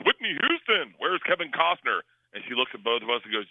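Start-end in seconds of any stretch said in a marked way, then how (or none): 0.1-2.0 anesthesiologist's exclamation. Retold or imitated. Anesthesiologist spotting the star beside the patient, exclaiming "[name]". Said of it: "anesthesiologist comes back and he goes".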